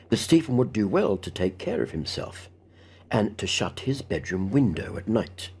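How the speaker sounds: usual speaking voice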